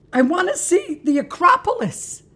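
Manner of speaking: high, loud proclamation as an ancient philosopher; hammed-up grand demand; impassioned Aristotle. Aristotle tells the steward what he came for, impassioned.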